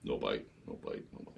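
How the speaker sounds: very soft, low, fading repeats; hushed letdown. Retold or imitated. Captain trolling over the spot without a bite, hushed. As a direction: murmur it away to nothing.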